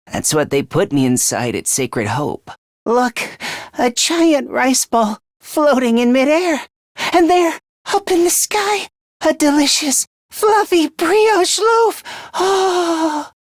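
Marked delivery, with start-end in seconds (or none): 2.8-13.3 very high-pitched, swooning wonder; dreamy rapture. Acted. Dazed boy spots delicious food floating in the sky, swooning with delight. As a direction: marvel ecstatically at a heavenly vision.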